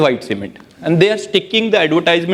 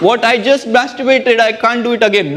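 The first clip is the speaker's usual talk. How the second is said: high, put-on whining victim voice; whiny protest; 7 semitones higher than usual. Victim tells the robber he can't, high whine. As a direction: protest like a spent, desperate man.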